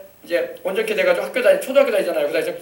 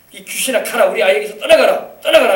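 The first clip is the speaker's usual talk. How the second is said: commanding rebuke building to a loud, high cry; stern rebuke; 3 semitones higher, 7 dB louder than usual. Retold or imitated. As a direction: order the evil spirit out, louder at the end. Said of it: "예수님 이름으로 꾸짖은 거예요". (귀신을 꾸짖는 단호한 명령)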